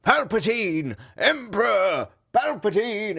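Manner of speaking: high, drawn-out hammed-up chant; mock yelling. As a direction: imitate silly troops yelling for their ruler. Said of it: "There was no one yelling".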